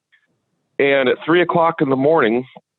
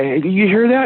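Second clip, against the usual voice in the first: retold yell; 8 semitones higher than usual. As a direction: reenact calling over to the other guy. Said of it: "And I yelled over".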